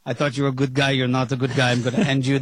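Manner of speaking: low-pitched Spanish impression that slips into Russian; bad Spanish accent. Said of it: "That's my bad Spanish, p-, sounds Russian impression".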